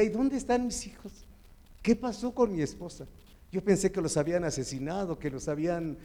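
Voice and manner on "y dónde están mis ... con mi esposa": mildly anguished, higher-pitched retold cry; retold tearful plea. Preacher reliving finding his house empty, mildly tearful. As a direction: recall a tearful search without real sobbing. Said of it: "yo lloraba y decía".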